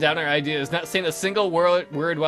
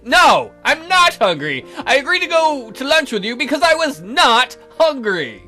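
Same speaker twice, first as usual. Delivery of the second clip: higher, louder, emphatic protest; indignant denial; 7 semitones higher, 5 dB louder than usual. Acted. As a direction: protest too much, leaning on each NOT. Acted indignant denial to questioner.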